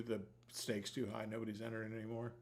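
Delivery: usual speaking voice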